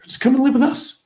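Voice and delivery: very high-pitched, ready offer; imitated eager invitation. Swedish-based friend inviting divorced buddy to stay, eager. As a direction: mimic a friend offering without hesitation.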